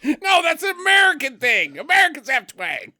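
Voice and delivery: high, loud comic outburst; loud protest. Real, not acted. Co-host objecting that twang is American, high shouted protest. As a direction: burst out in amused objection.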